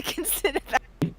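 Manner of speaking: ordinary talk, nothing marked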